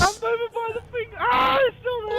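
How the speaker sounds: high, crying shout; frightened, near panic; scared cry. Real, not acted. Monster still there.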